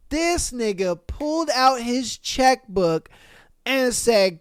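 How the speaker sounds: normal talking voice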